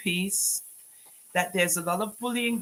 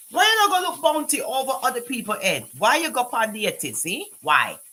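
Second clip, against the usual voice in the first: challenging a troll; 6 semitones higher, 4 dB louder than usual. Real, not acted. Confronting a troll.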